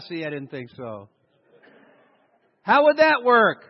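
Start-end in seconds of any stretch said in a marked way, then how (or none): 2.6-3.6 louder and higher, incredulous; incredulous challenge. Real, not acted. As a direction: call it out to the room in disbelief. Rhetorical challenge to congregation.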